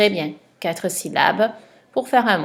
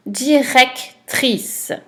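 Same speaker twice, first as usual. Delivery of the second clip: very slow, higher, drawn out; slow dictation; 4 semitones higher, much slower than usual. Shown as a demonstration. Teacher reading a word to a pupil, very slowly. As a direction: stretch every syllable.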